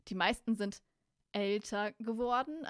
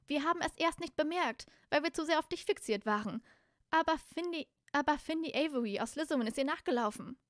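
higher-pitched character voice; girl character explaining; 6 semitones higher, 5 dB louder than usual. Acted. Girl tells her friends who ran after whom, high character voice. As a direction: explain it in a high, girlish story voice.

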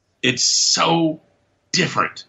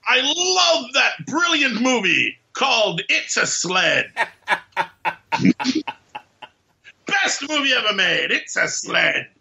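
high, slow hammed-up gushing; gleefully spoil the ending; gushing spoiler voice; 10 semitones higher than usual. Mock spoiler announcement.

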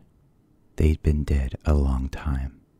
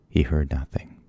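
plain reading voice

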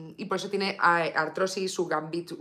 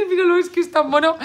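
squealing laugh, louder and higher; laughing with delight; 9 semitones higher, 6 dB louder than usual. Real, not acted. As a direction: gush through giggles at the puppy. Melting over her cute dog.

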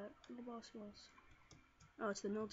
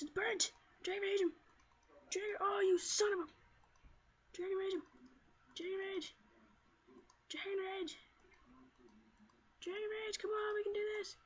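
high, keyed-up battle commands; urgent urging; 7 semitones higher than usual. Real, not acted. Player to own Pokémon mid-battle, urgent urging. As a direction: urge your fighter on, voice pitched right up.

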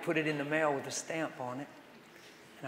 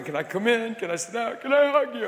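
son's plea, much higher and louder; pleading son; 8 semitones higher, 6 dB louder than usual. Retold or imitated. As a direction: plead like a broken son asking for a hug. Son breaks and cries at door.

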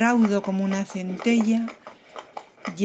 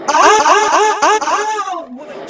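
long, drawn-out high "ah" cries climbing in triumph; stretched high mock-heroic ahs; high triumphant cries; 10 semitones higher, 8 dB louder, much slower than usual. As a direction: wail it like a hammed-up victory howl.

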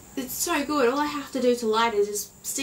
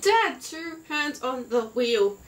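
slow; much slower than usual. Retold or imitated. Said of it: "I'm like".

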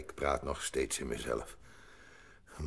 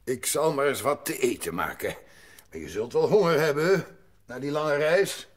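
loud, higher grandpa voice; old grandpa voice; 12 semitones higher, 10 dB louder than usual. Acted. Grandpa answering Heidi about the view, loud and high. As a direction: play the kindly old grandfather.

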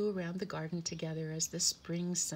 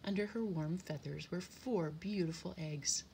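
plain reading voice

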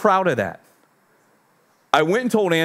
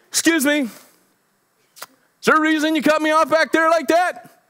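high, tightly contained mock anger; holding everything back; 14 semitones higher than usual. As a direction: re-enact barely restrained road rage, pitched way up. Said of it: "I was holding everything back".

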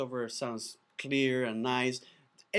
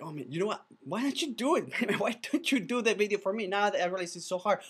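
higher, laughing plea from a frustrated viewer; laughing mock-exasperated viewer; 7 semitones higher, 3 dB louder than usual. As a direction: beg him to do it, half laughing.

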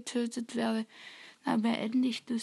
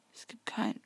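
whispering; 5 dB quieter than usual. Real, not acted. Hiding, whispering.

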